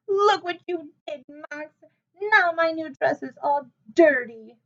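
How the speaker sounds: high, whiny complaint still half crying; a hurt little girl whimpering her protest; tearful whining. A narrator's reading. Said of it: "she sobbed".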